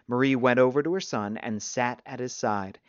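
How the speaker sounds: ordinary delivery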